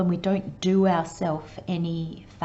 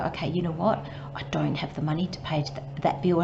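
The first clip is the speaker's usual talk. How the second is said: soft whispered admission; whispered acceptance; 3 semitones lower, 5 dB quieter, faster than usual. Retold or imitated. Person calmly accepting an unpaid bill, in a whisper.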